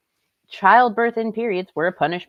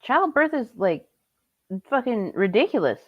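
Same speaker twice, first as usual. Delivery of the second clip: outraged disbelief; 6 semitones higher than usual. Real, not acted. Outraged rebuttal of joys claim.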